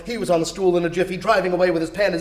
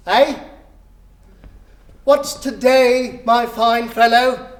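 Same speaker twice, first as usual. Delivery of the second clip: loud, higher, slow call; 5 semitones higher, 6 dB louder, slower than usual. Acted. Scrooge calling down to a boy below, loud and slow. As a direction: stretch it out to reach the street.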